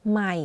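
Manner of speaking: ใหม่ in a low, slightly falling tone. Shown as a demonstration. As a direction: let ใหม่ sink gently to low pitch. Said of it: "my voice goes in a lower pitch".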